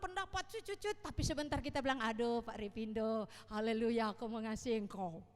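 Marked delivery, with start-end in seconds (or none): 2.0-5.2 mock pious gushing. Mocking gushy churchgoer.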